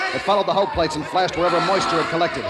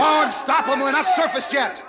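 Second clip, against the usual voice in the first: frantic shout; 9 semitones higher, slower than usual. Acted. Captain shouting at Ward to stop the crew, frantic. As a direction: roar at Ward to hold them back. Crew rushing hatches underwater.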